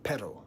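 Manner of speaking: perro with a rolled Spanish R. Shown as a demonstration. Teaching the Spanish word for dog, rolled R. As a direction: trill the R in perro. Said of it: "with Spanish".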